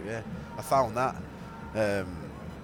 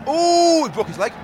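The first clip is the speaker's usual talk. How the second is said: shocked; 13 semitones higher, 10 dB louder, slower than usual. Real, not acted. Sees injury live at match.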